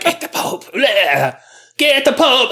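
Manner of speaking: strained cry breaking into wordless noise; hammed-up seizure voice, words forced out; faked fit. Visionary summoning the Pope mid-fit, strained mock cries.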